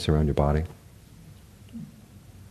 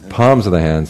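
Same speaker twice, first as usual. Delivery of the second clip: ordinary talk, nothing marked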